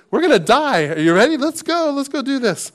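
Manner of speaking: high-pitched eager rallying; Thomas. Retold or imitated. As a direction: urge friends on into danger, voice lifted. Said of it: "I think that's how Thomas felt".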